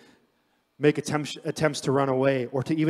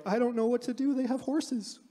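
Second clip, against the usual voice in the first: whiny panicking Israelites; 9 semitones higher, 5 dB quieter than usual. Retold or imitated. Panicking Israelites voiced mockingly.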